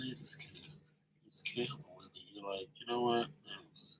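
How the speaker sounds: very soft, low disbelieving mutter; muttered disbelief. Retold or imitated. Comic voicing the jilted man, barely audible and resigned. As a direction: mutter it under your breath, giving up.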